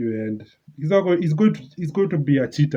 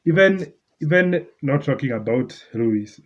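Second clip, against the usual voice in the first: ordinary talk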